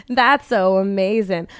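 ordinary talk

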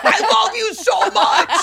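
high, slow, laughing gush; gushing reconciliation. Retold or imitated. Mom and husband after arguing, high laughing gush. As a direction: coo it like after a fight.

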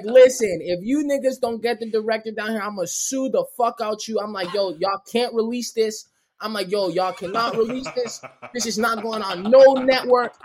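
high, insistent re-enacted threat; mock outrage. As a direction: threaten to sue in mock indignation. Re-enacts threatening guards with lawsuit.

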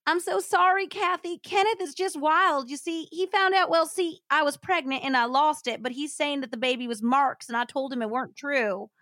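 high-pitched pleading Southern woman; Southern accent. Acted. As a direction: apologize frantically in a hillbilly twang. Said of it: "read this in a Southern accent".

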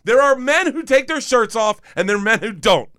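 high-pitched, building comic tirade; mock outraged rant. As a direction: rant indignantly, peaking in a squealed protest. Mock rant at guest's husband.

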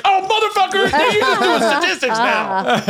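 very high, stretched-out indignant yelp; hammed-up comic fury; mock outrage. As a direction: act out exasperated disbelief, voice way up. Acted outraged reply to psychic.

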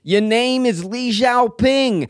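slow quoted accusation. Retold or imitated. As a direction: spell out the accusation slowly. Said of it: "The line is".

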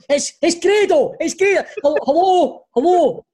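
high, loud, slow calling hello into phone; confused shouting on phone. Retold or imitated. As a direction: call out to a phone nobody answers. Imitating old man on FaceTime.

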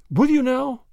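high, bright-eyed eager question. A narrator's reading. Relieved grandma asking the dark man, eager and hopeful. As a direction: perk up hopefully. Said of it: "said Grandma eagerly".

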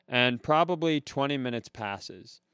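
ordinary talk

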